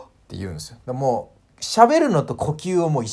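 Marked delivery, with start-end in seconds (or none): none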